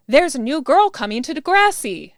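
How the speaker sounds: loud, high excited announcement; excited shout. A narrator's reading. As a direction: shout the big news excitedly. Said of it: "Adam shouts excitedly".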